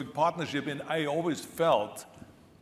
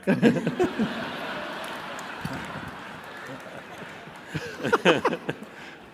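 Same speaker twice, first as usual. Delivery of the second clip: long real laugh; 4 semitones higher, 5 dB louder than usual. Real, not acted. Audience laughs at long story.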